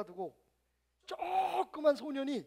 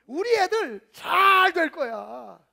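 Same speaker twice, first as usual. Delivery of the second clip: loud and drawn-out boast; proud bold declaration; 5 semitones higher, 14 dB louder, much slower than usual. Retold or imitated. Believer boasting about the kids' future, loud and slow. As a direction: proclaim it big and slow, puffed up.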